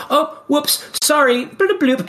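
high sheepish oops, then bloop noises; sheepish Susie. Retold or imitated. Susie caught on the call, high sheepish sorry. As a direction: squeak out a guilty sorry.

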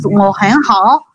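"wo hen hao" said slowly, syllable by syllable, with care; careful, slow Mandarin syllables; slow Mandarin demo. Shown as a demonstration. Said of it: "slow Mandarin pronunciation demo".

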